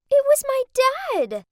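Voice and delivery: shocked. Acted. Girl sees her own dad working as the valet, shocked cry. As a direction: blurt out the discovery, stunned.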